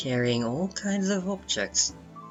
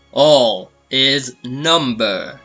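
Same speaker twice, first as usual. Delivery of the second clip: loud, slow proclamation, each word separated; slow, emphatic declaration; proclaimed watchword; 11 dB louder, much slower than usual. Acted. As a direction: boom it out like a cult motto. Quoting the cult's watchword.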